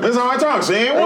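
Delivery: high, lightly voiced gangster; a hint of fifties gangster; old-time gangster. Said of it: "like a fifties gangster".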